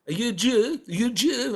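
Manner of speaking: a light Borat imitation asking twice; Borat impression. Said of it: "he goes".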